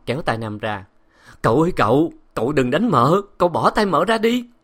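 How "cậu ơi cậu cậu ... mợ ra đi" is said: a higher, louder begging maid; frantic plea. A narrator's reading. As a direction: beg the master to stop. Old maid begs master stop.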